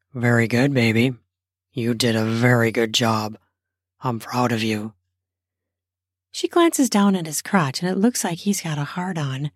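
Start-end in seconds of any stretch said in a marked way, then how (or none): 0.1-4.9 low male voice, proud and pleased; husband voice. Acted. Narrator voices husband Jasper.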